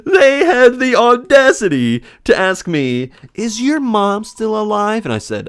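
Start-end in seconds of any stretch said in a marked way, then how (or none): 0.0-3.1 high, loud, slow, half-laughing outrage; outraged disbelief. Real, not acted. Narrator telling viewers about the rude kids, high laughing outrage. 3.3-5.1 put-on high, slow, innocent kid voice; imitating a kid. Kid asking the narrator about his mother, high slow childish voice. Kid's morbid question repeated.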